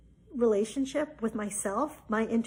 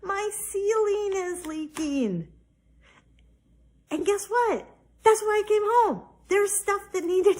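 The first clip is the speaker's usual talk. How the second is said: a touch of exclaimed surprise; excited realization; 10 semitones higher, 5 dB louder than usual. Real, not acted. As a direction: reveal it like a punchline.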